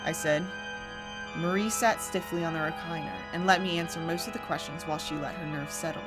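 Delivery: ordinary delivery, nothing marked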